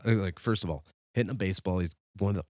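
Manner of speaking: ordinary talk, nothing marked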